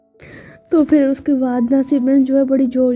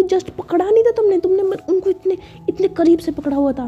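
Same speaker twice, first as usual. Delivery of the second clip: higher-pitched, mildly indignant correction; jealous protest; 6 semitones higher than usual. Acted. Ruhi objects to Simran holding sir closely, jealous protest.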